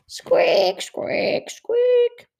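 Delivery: very high squeaks imitating sandals; squeaky shoe noises.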